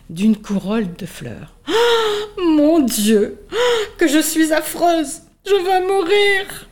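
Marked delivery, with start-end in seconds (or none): 1.7-2.3 sharp high gasp of horror; shocked gasp. Acted. Caterpillar seeing her reflection, high shocked gasp. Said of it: "caterpillar sees reflection". 2.4-6.7 high, slow despairing cry, almost sobbing; despairing wail. Acted. Caterpillar horrified by her reflection, high slow despairing cry. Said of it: "murmura-t-elle".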